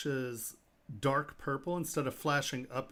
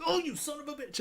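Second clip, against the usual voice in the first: higher, stunned "son of a bitch"; amazed curse; 14 semitones higher, faster than usual. Retold or imitated. Dealer seeing the opal, stunned and impressed. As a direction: blurt it out in disbelief at the stone.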